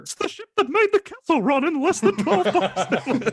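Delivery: high, loud mock Han Solo; hammy squeaky Harrison Ford; Harrison Ford impression. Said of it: "That sounds nothing like Harrison Ford".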